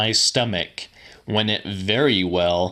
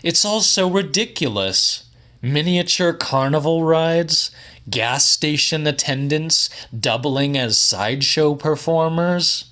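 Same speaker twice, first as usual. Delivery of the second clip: higher, scornful voice for Quisser; scoffing companion; 6 semitones higher than usual. Acted. Quisser dismissing the carnival story, higher and scornful. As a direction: sneer at the whole absurd idea.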